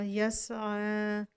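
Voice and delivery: यसआ very slow; recited word. Shown as a demonstration. Teacher recites the word slowly. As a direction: draw out यसआ. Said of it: "ऐसे ही वक्फ की हालत में पढ़ा जाएगा".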